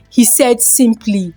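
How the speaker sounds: plain reading voice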